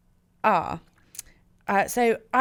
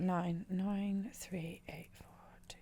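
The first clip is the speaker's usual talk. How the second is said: whispered counting; 16 dB quieter, slower than usual. Real, not acted. Working sums under breath.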